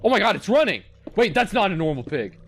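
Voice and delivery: freaking out as the pig runs at you; panicked. Real, not acted.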